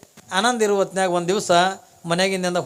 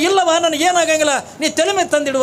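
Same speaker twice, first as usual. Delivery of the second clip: insistent command; 8 semitones higher, 4 dB louder than usual. Acted. Holy man insists on pot.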